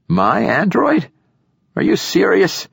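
high-pitched, incredulous questions; surprised. A narrator's reading. Old poet asked to give up his android, surprised. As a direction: question it in rising disbelief. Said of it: "The poet showed surprise".

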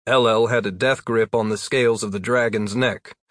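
usual speaking voice

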